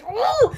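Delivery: high-pitched swooning "ooh"; excited squeal. Real, not acted. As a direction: let out a high fangirl ooh. Gushing over how he was dressed.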